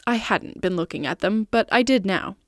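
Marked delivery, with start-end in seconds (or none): none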